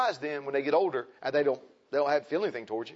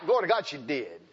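normal talking voice

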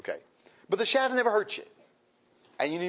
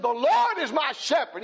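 higher, louder proud proclamation; bold declaration; 9 semitones higher, 4 dB louder than usual. Retold or imitated. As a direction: boldly declare it. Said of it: "He says it boldly".

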